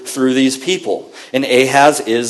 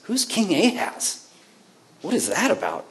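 puzzled listener; 6 semitones higher, 9 dB quieter than usual. Pretend.

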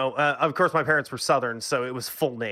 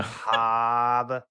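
put-on Boston accent; 2 semitones lower than usual. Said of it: "That's Boston, man, not Philly".

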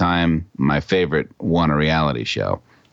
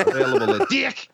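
perfume ad announcer. Acted. Commercial tagline parody, high.

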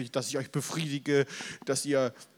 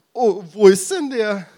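high, loud, wide-eyed crowd voice; mock crowd asking loudly; eager question; 6 semitones higher, 12 dB louder than usual. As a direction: call out searching for him. Crowd asking where God is.

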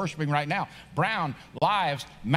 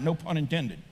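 ordinary talk, nothing marked

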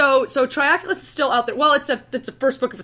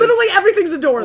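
exasperated; 8 semitones higher, 7 dB louder, much faster than usual. Real, not acted. Exasperated at doors.

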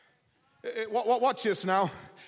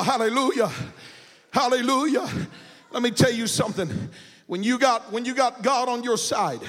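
usual speaking voice

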